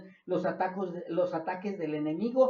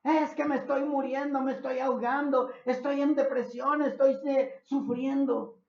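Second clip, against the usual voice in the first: queja desesperada; 9 semitones higher, 7 dB louder than usual. Retold or imitated. Quejas imitadas de los que gritan.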